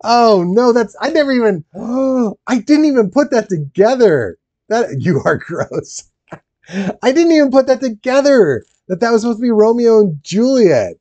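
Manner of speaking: can't believe they missed it; stunned disbelief. Real, not acted. Streamer to chat, stunned by the Romeo and Juliet link. Keeps reacting to the realization.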